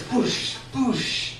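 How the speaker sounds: soft whooshing raft bumps; imitated water splash. Raft going down the slide, imitated whooshing.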